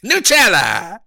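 hammed-up Italian exclamations; mock Italian food cries; Italian accent. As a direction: sing out each word like a cartoon Italian. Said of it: "That's the lazy man's in Italy".

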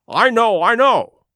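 high, loud urgent cry; cried out. A narrator's reading. Doctor crying out to Jim, high and loud. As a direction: cry it out to stop him. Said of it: "he cried".

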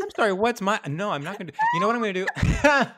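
loud, playful protest; laughing shout. Real, not acted. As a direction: yell it back, half laughing. Refusing to do accent.

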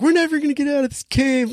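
high, fast panicked wail; hammed-up despair, voice shooting up; mock panic. Girls trapped in the cave panicking.

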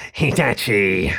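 slow, drawn-out rat-man radio voice; crackly rat villain. A narrator's reading. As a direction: announce the name slowly as a rat-like foe. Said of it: "crackly and slightly squeaky".

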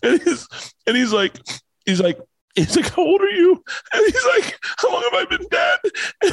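can barely hold back tears telling the ending; voice breaking. Real, not acted. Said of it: "I'm getting emotional right now".

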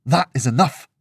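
loud, firm, angry command; furious reprimand. A narrator's reading. Furious friend stopping her rant, stern and loud. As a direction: put a stop to it, loudly. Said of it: "fury on his face".

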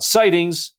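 put-on little girl's call-out, pitched high; retold yell. Young daughter calling out the family game word to a parent, mock yell. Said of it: "she yelled out".

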